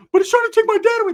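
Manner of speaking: fast, high, jealous whine; Ricky. Retold or imitated. Jealous son fears losing his dad, high mock wail. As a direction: wail it in a loud comic falsetto. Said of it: "Stop being jealous".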